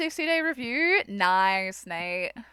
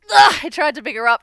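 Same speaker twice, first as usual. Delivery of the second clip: frustrated groan; 3 semitones higher, 13 dB louder, faster than usual. Real, not acted. Cat clawed her.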